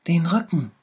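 high, pitiful little tiger voice; sick little tiger. A narrator's reading. Little tiger shows the bear where it hurts, high and pitiful. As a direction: whimper it, poor sick cub. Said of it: "sagte der kleine Tiger".

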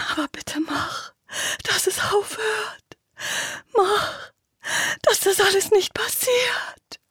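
very high, strained pleading; terrified begging. Acted. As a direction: beg in panic, close to tears. Terrified girl begs, near breaking.

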